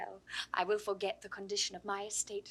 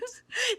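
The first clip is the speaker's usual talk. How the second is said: short high laugh; 12 semitones higher than usual. Acted. Laughing at the fool joke.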